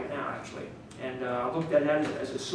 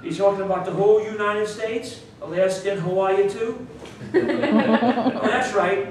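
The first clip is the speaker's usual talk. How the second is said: mock confused local; 6 semitones higher, 9 dB louder, slower than usual. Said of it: "acting a confused listener".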